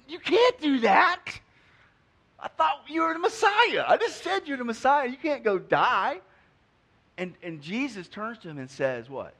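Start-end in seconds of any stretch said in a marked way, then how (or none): 0.1-6.2 much higher, louder indignant protest; hammed-up Peter, high and upset. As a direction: plead with him not to go die. Peter rebukes Jesus in retelling.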